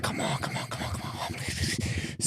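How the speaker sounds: strained, voiceless begging; acted desperate whisper, soft and pressed; bursting, desperate pleading. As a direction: plead urgently under your breath, about to burst. Bursting king pleads for toilet.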